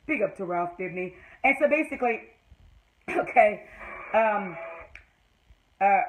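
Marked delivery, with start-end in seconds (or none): 0.1-1.1 softer, lower muttered praise; quiet grudging repeat. Real, not acted. Vlogger quietly repeating her reluctant praise. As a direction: say it again under your breath. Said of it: "this is hard for me to do".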